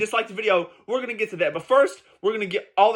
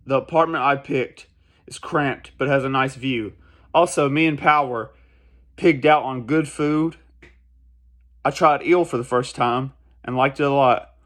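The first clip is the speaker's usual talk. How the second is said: a lower, steady reading of Denji's lines, lightly voiced; reading manga aloud; 5 semitones lower than usual. Reading Denji's lines from the manga to viewers, a lower, steady read. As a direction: read the panels out as Denji, understated.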